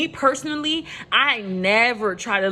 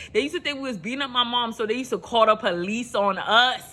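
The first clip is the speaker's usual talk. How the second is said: normal talking voice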